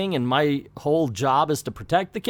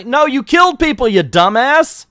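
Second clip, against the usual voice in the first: higher and louder, slowing on the insult; angry yell; 9 semitones higher, 9 dB louder than usual. Acted. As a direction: bellow it at the fool who killed people. Guard yelling at the intruder.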